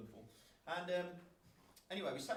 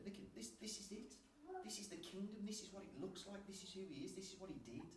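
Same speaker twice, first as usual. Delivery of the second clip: soft sincere telling; 6 semitones higher, 6 dB quieter than usual. Retold or imitated. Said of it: "when you say to them".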